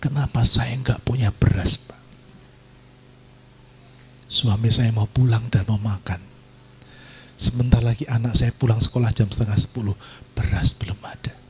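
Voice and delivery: low, hushed imitation of weeping; retold tearful plea. Mother without rice pleads to pastor, understated tearful voice. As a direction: plead quietly, lightly imitating tears. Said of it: "nangis-nangis".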